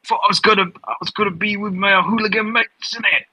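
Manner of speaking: mocking the vlogger with a fake Cockney innit; mock British accent. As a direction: imitate the American faking a British accent. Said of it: "He's like".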